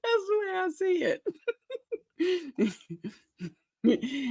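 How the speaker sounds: squeaky high helpless laugh-talk; laughing while talking. Real, not acted. Host laughing at her own exclamation-point joke, high laughing voice. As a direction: push the words out through high laughter.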